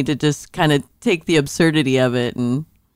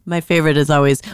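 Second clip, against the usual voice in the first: ordinary talk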